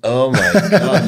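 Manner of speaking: laughing. Real, not acted. Host laughing at guest's joke.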